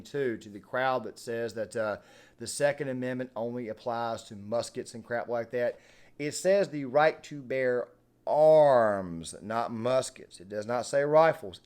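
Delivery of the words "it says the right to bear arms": very slow, loud, each word stressed; slow emphatic. Real, not acted. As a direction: spell it out word by word, louder. Quoting the Second Amendment.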